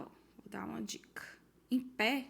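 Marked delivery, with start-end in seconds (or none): 0.0-1.2 sussurro bem baixinho; dica sussurrada. Real, not acted. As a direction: sussurre como quem entrega uma dica. Professora segreda uma dica. (dica em voz de segredo)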